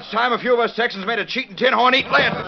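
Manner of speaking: mean it, hand on the gun; angry threat. Acted.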